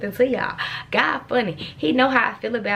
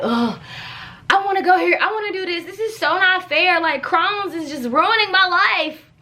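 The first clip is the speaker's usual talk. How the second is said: whiny complaining; 8 semitones higher than usual. Retold or imitated. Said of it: "I was complaining".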